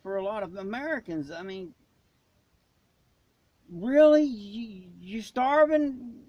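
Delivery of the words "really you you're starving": incredulous. Real, not acted. Disbelief at people starving.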